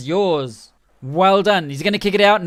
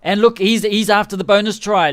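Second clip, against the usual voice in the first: ordinary talk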